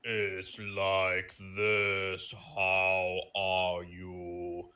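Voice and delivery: extremely slow, deep voice; King Kai. Retold or imitated. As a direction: stretch each word out, low and ponderous. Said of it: "But in the Japanese version".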